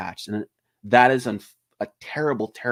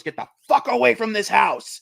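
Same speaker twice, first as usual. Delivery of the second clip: higher, hammed-up angry yell; Laurie yelling at firemen; 10 semitones higher, 4 dB louder than usual. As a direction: bark it at the fire department. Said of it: "she goes".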